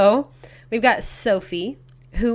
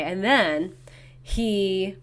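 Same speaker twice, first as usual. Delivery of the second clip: usual speaking voice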